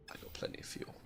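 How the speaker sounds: whispering under breath; whispered aside. Real, not acted. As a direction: murmur it to yourself, unvoiced. Quick aside while playing.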